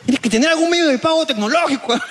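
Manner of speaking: high-pitched indignant lecture; comic exasperated customer; mock scolding. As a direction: scold the shopkeeper in pretend outrage. Acts rich customer lecturing shopkeeper.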